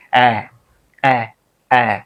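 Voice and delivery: অ্যা said with both lips spread wide; spread-lip a sound. Shown as a demonstration. Teacher demonstrating the অ্যা vowel for students. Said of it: "দুইটা ঠোঁটে ওরা প্রসারিত করে".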